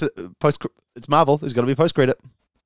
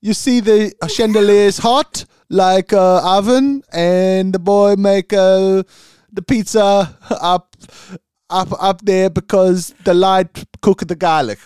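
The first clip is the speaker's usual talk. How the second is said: put-on Swedish accent; 7 semitones higher, 6 dB louder than usual. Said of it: "He goes".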